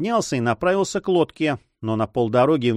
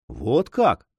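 plain reading voice

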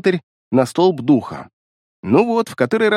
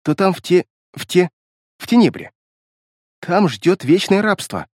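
nervous stammer on the feared word; halting, agitated; 3 semitones higher than usual. A narrator's reading. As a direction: break off and restart, anxiously. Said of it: "первушка говорила отрывисто, с волнением". (отрывисто и взволнованно, с запинками)

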